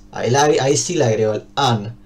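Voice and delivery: normal talking voice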